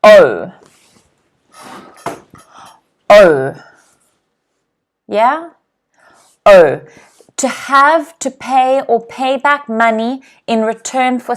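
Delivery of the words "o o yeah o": O held and spoken loud and clear; teacher's model O; model word. Shown as a demonstration. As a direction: repeat O clearly for the class. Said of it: "Can everyone repeat this at home?".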